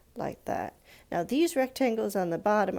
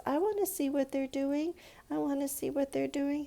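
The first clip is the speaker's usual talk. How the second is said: put-on high, eager child voice; a small girl begging to see inside; little girl pleading; 7 semitones higher than usual. Teacher voicing a curious little girl, high and eager.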